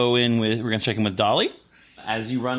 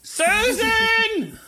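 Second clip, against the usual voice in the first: very high, drawn-out name call. Acted. Professor calls Susan a second time, very high and insistent.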